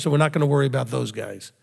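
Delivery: ordinary delivery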